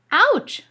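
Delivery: high, loud cried ouch. Acted. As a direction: yelp out in pain. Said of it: "cried Jimmy".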